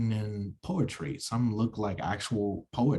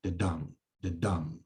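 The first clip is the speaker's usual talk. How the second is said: the soft, dum hit hard; weak the then strong dum; da-DUM rhythm; stress on dum; 3 semitones lower, 6 dB quieter than usual. Shown as a demonstration. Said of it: "It's two syllables".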